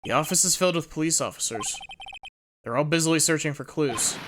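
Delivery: ordinary delivery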